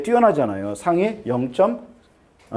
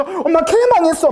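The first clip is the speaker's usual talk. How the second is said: put-on high, loud wailing teen girl; mock whiny daughter; 13 semitones higher, 5 dB louder than usual. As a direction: whine like the world ended over one mistake. Daughter wailing over one wrong answer.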